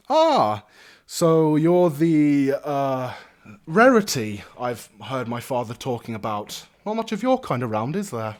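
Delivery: jovial noble greeting a curiosity; cheery young lord. Acted. Young lord greets a rare stranger, cheery and lordly.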